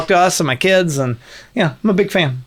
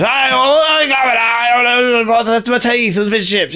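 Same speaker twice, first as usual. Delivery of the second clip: hammed-up Cockney, high and drawn out; mock Cockney accent; 8 semitones higher, slower than usual. Host demos the show's next episode, exaggerated Cockney accent. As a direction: wail it in a badly overdone Cockney. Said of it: "a really offensive Cockney accent".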